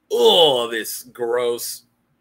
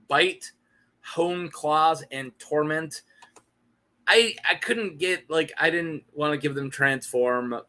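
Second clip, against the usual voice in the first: ordinary talk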